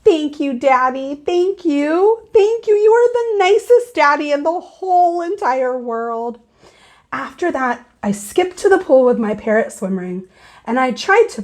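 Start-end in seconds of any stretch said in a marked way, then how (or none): 0.1-6.4 high, gushing thanks; child thanking Daddy over and over; happy thank-you. Acted. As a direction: hug Daddy and gush your thanks. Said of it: "I hugged and hugged him, very happy".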